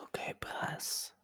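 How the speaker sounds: soft breathy whisper. Real, not acted. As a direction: whisper it close to the mic. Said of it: "Why are you whispering?".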